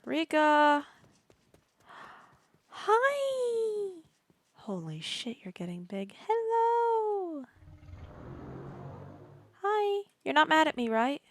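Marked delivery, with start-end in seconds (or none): 0.0-3.9 high, loud squeaky call to the pet. Real, not acted. Player greeting pet Rika, high pet voice. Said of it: "With the stupid high voice". 6.3-11.2 high pet voice. Real, not acted. Player greeting pet Rika, high pet voice. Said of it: "With the stupid high voice".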